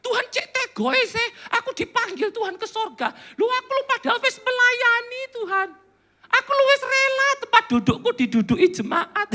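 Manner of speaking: high-pitched, hammed-up whining to God; comic aggrieved wail; mock tearful protest. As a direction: complain to God as if about to cry. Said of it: "Musa nggak menangis".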